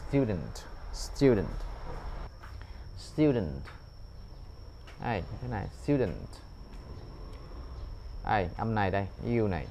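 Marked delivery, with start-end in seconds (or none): 0.1-6.1 "student" with no schwa, straight to u; student without schwa. Shown as a demonstration. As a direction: say "student" dropping the ơ sound. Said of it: "mất không có âm ơ luôn". (đọc "student", bỏ hẳn âm ơ)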